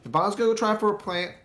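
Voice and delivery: high, loud hyped shout over the kill; excited play-by-play. Real, not acted. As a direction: yell it like the crowd just erupted. Caster hyped over a clutch kill.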